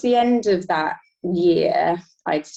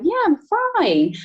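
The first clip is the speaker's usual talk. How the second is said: high, breezy "I'm fine", pitched way up; polite British brush-off. Retold or imitated. Mocking the polite British answer she didn't give, high and breezy. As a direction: toss it off lightly, brightly high. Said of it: "in this British culture".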